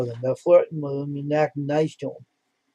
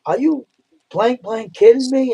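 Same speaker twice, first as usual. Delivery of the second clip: higher, louder, slow outraged disbelief; flipped out; 10 semitones higher, 5 dB louder, slower than usual. Retold or imitated. Administrator flipping out over the nurses, outraged yell. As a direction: hit it like a boss who flips out. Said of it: "she flipped out".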